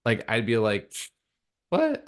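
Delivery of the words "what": high-pitched disbelieving "what"; mock-incredulous, pitched way up. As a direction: react as if the idea is absurd. Acting out his former self's disbelief.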